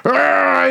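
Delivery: long, high mock scream of pain; drawn-out high yelp, re-enacted; pained yell. Friend re-enacts having his finger bitten, pained yell.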